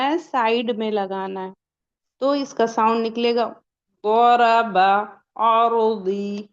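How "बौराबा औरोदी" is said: बौराबा and औरोदी stretched slowly, heavy side-tongue ḍ; slow ḍād sound. Shown as a demonstration. Teacher shows students how the ḍād should come out, very slowly. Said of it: "इस तरीके से निकलेगा".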